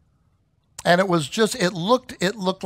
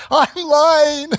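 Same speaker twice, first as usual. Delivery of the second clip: tearful confession; 6 semitones higher, 5 dB louder than usual. Retold or imitated. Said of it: "broke down in tears".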